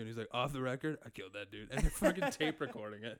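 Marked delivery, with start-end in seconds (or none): 0.3-1.7 low, confiding suspect; mock suspect. Co-host teases host as interview suspect, low confiding aside. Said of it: "You're in a police interview, and he's like".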